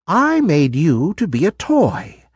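put-on a kind old lady revealing a secret; kindly old toy maker.

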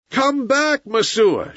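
high, insistent call; crowd shouting. A narrator's reading. Crowd orders Messua away from Mowgli, shouting. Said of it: "shouted the crowd".

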